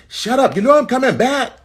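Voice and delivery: high, loud, exasperated scolding; screaming parent. Acted. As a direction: imitate a parent yelling at a crying toddler. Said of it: "stop screaming at them".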